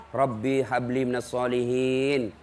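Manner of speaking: slow, soft prayer to a grandchild; tender prayer. Retold or imitated. Grandmother praying over the grandchild while feeding him, slow and soft. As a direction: murmur it lovingly, slowly.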